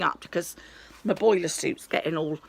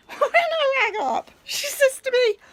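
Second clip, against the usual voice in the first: much higher, slow, laughing through the words; 5 dB louder, slower than usual. Real, not acted. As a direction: giggle as you start the story. Laughs telling absurd call.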